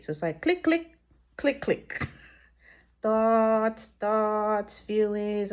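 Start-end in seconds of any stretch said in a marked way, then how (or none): 0.6-2.1 higher, snappy spoken clicks; clicking sounds. Retold or imitated. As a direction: tick them off like switches. Imitating thoughts clicking.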